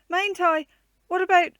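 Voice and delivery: high, loud and eager; child begging mother to be let in; eager pleading. A narrator's reading. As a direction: keep begging, cut off mid-sentence. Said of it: "burst out Joan".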